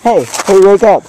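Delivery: loud wake-up call. Real, not acted. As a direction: shout to wake him. Waking a knocked-out pupil.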